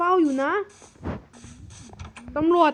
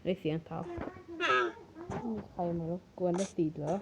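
much lower, softer, wary; suspicious murmur; 7 semitones lower, 11 dB quieter than usual. Acted. Guard hears footsteps and murmurs warily. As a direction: hear footsteps and hush.

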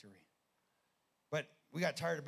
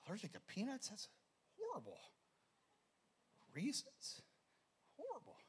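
mocking mother; 10 semitones higher, 5 dB quieter than usual.

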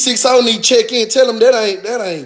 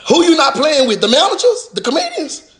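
incredulous; 7 semitones higher than usual. Real, not acted. Ranting at the attackers, rising disbelief. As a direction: voice shooting up high in disbelief.

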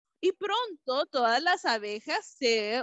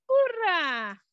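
cheer; 5 semitones higher, 4 dB louder than usual. A narrator's reading. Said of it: "dijo la princesa Peggy".

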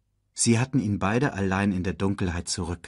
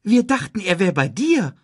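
high, louder surprised reply; 11 semitones higher, 5 dB louder than usual. A narrator's reading. Timon tells Nala he thought Simba was with her, surprised. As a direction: answer in a startled, high-pitched voice. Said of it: "Timon schaute überrascht".

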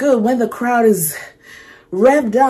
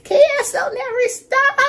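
very high, teasing sing-song; giddy falsetto tease; high playful sing-song. Acted. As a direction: sing your own name into the lyric, up high. Playful self-reference singing lyric.

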